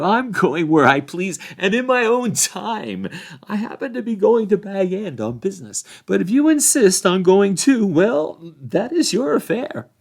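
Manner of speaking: high, loud, lofty rebuff; dismissive Frodo. A narrator's reading. Frodo refusing the sheriffs' orders, dismissive and loud. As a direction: brush the officials off grandly.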